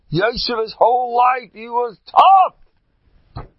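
loud, high and very slow, each word driven home; booming emphasis. Acted. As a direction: preach it like a hammer blow. Preacher hammering a point.

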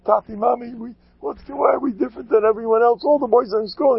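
put-on high pleading child voice; whining kids. Children sent home begging their parents, high whining.

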